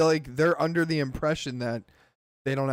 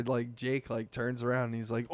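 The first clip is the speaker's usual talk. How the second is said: normal talking voice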